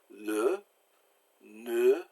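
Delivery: le and ne with a short pursed-lip 'uh'; soft French schwa. Shown as a demonstration. Teacher giving French examples, soft short schwa. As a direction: purse your lips for the vowel in le, ne. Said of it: "real soft and short".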